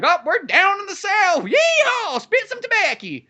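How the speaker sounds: mock the South like an over-the-top cartoon yokel; cartoon Southern accent. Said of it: "Like it's a fucking cartoon show".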